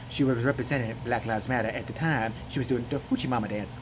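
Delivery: disinterested low drone; Jesse Lee Peterson. Retold or imitated. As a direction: mumble the objection sleepily. Interviewer impression resumes.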